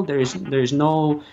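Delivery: normal talking voice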